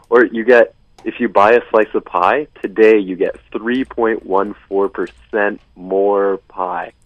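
normal talking voice

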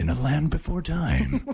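mock-epic trailer announcer; movie guy voice. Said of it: "I love the movie guy voice".

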